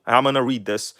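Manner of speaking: normal talking voice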